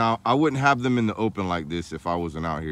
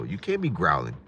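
ordinary talk

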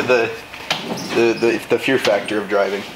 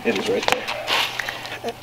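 quiet; 5 semitones lower, 8 dB quieter, much slower than usual. Real, not acted. Muttered aside.

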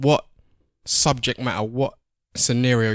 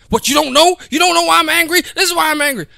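high, loud exasperated outburst; indignant girlfriend demanding he should know; mock angry woman; 6 dB louder than usual. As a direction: play the woman going off, pitched way up. Retold angry woman going off.

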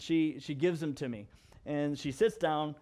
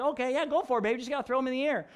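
encouraging dad; 8 semitones higher, much faster than usual. Retold or imitated. Dad encouraging toddler, acted.

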